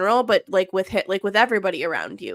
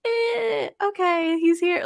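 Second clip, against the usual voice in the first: much higher, casual sing-song; breezy mock nonchalance; 11 semitones higher, slower than usual. Mocking a movie mother who gives birth with ease, high and breezy. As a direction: toss it off like birth was nothing.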